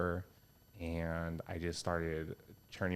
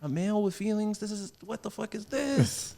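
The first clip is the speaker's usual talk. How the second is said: high, loud mock disbelief; amazed people; 13 semitones higher, 5 dB louder than usual. Retelling audience reaction to a feeling male, mock astonished. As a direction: exclaim in put-on astonishment, pitched way up. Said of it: "now people are like".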